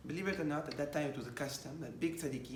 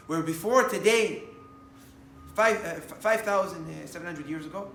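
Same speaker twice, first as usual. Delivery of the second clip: preaching loud; 3 semitones higher, 10 dB louder than usual. Acted. Preacher builds to climax.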